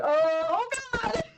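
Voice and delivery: high, panicked cry from the party; mock panic. Party members panicking when she passes out, high panicked cry. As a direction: yelp it like friends panicking. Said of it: "everyone's like".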